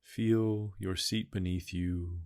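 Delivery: ordinary talk, nothing marked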